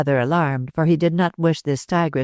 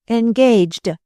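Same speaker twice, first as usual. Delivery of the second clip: tossed back higher, mocking his word; scornful repeat; 7 semitones higher, 4 dB louder than usual. A narrator's reading. Lola mocking George's excuse, scornful. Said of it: "she repeated with a flash of her wonderful eyes".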